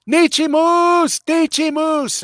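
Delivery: calling out high and loud; mock scream. Man by his truck calling to his wife, high loud yell. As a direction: yell the pet name across the lot. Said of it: "he started screaming".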